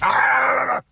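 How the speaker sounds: loud, high zombie roar; zombie walker growl. Retold or imitated. Kid on top of the bully like a zombie, loud roar. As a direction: snarl like a Walking Dead walker. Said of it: "The Walking Dead walkers".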